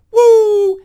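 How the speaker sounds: loud high hype whoop; high shouted woo; high excited whoop. Real, not acted. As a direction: whoop to pump up the room. Hyping himself up.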